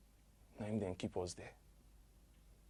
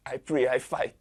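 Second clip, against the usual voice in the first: desperate cry; 4 semitones higher, 10 dB louder than usual. Acted. Desperate recall, loud burst.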